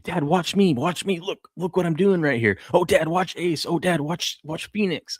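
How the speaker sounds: put-on high, pleading kid voice; eager kids. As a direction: beg dad for attention like a little boy. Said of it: "and they'll be like".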